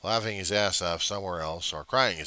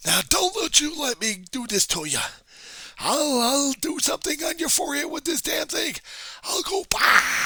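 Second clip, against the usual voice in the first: much higher, louder ranting commands; mock fanatic shout; 6 dB louder than usual. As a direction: yell it like a crazed prophet with his paper towel. Continuing mock crazed ranting.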